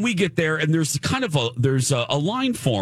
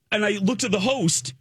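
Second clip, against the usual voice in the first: usual speaking voice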